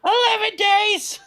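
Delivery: very high, slow kobold shriek; squeaky yappy lizard chatter. Acted. Group joins the kobold bit.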